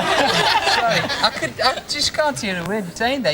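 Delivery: apologising through real laughter; laughing. Real, not acted. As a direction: laugh while saying sorry. Can't hear the caller.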